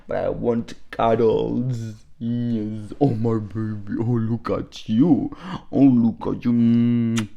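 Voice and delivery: cooing at pet. Real, not acted. Cuddling with her pet.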